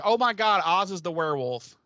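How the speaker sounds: excited realization. Retold or imitated. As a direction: blurt out the big discovery. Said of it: "I would have immediately been like".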